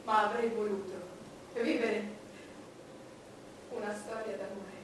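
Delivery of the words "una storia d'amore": ordinary delivery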